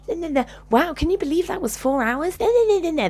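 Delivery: high-pitched mocking babble of a superficial woman; superficial chatter imitation. Speaker mocking a woman after the session, superficial high babble. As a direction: mimic her gushing, way up high. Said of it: "very superficial energy".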